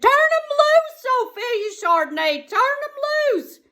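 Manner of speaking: daddy hollering at his daughter, high and loud; yelling. Retold or imitated. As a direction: holler it so she hears you over the dogs. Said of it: "Finally, I heard my daddy".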